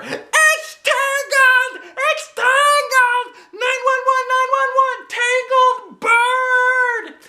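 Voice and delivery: hysterical holler. A narrator's reading. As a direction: stretch every word in a screaming panic. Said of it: "I hollered".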